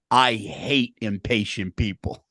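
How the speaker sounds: low, drawn-out deadpan impression; low and heavy, every word stretched; mock slow put-down. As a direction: imitate a slow, deliberate complaint said aloud. Acting out Joe's public put-down.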